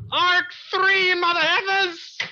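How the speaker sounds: high, loud hype for the new arc; rowdy excited cheer; hyped shout. Real, not acted.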